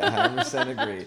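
laughing agreement. Real, not acted. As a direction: laugh through it.

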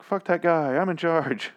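high, defiant jab at Gregory; mock defiant. Retelling Maggie's defiant response.

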